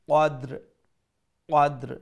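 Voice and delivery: কদ্র with both letters silent; stopped form. Shown as a demonstration. Teacher shows waqf, both letters sakin. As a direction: clip কদ্র, no vowel on dal or ra. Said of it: "সাকিন হিসাবে উচ্চারণ করবো".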